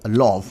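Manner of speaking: "love" with an open, rounded vowel; Nigerian pronunciation. Shown as a demonstration. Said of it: "we pronounce it as lo".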